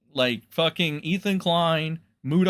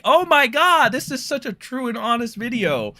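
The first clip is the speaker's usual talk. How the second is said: higher mock-gushing praise; a touch of mock enthusiasm; sarcastic gushing; 7 semitones higher than usual. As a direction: fake awe. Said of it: "enough to be like".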